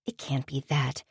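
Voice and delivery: muttered. A narrator's reading. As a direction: mumble it under the breath while busy. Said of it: "she muttered".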